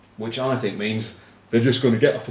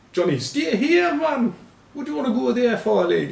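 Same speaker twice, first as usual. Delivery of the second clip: high-pitched mock Geordie, pleading with Johnny; hammed-up Newcastle accent, coaxing him to stay; Geordie plea; 12 semitones higher than usual. Newcastle fan begging Johnny Wilkinson to stay, high mock Geordie.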